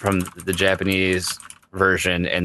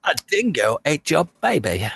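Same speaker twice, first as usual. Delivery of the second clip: put-on Australian accent; 6 semitones higher than usual. Riffing on Australians, high mock Aussie accent. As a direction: throw on a cartoon Australian voice. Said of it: "an Australian film".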